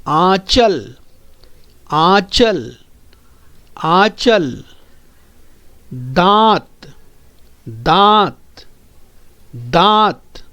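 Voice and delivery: आंचल and दांत each said clearly with a nasal aa; careful pronunciation. Shown as a demonstration. Teacher drilling chandrabindu words, clear nasal vowel. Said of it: "देखिए इसका उच्चारण किस तरह से होता है".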